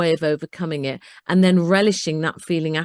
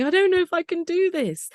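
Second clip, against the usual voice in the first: much higher-pitched mock-tearful plea; retold tearful wail; 12 semitones higher than usual. Phoning her brother from the roadside, retold as tearful. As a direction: imitate yourself phoning in tears, voice pitched way up. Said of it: "phone my brother in tears going".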